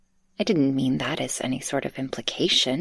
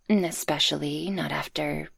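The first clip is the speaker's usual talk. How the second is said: ordinary talk, nothing marked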